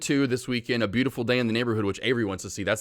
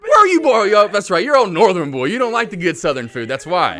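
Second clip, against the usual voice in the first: high, loud mock outrage; joking loud mock scolding; loud teasing; 9 semitones higher, 11 dB louder than usual. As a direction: rib him like a proud southerner. Teasing a northerner loudly.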